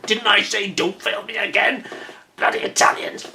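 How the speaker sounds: put-on menacing villain; mock villain voice. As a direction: growl it like a film baddie scolding a minion. Villain voice joke aside.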